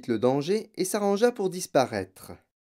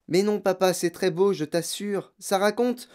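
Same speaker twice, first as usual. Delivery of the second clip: put-on lightly higher, earnest little girl; pleading girl; 6 semitones higher than usual. Mathilda defending her book to her father, earnestly. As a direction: assure him gently.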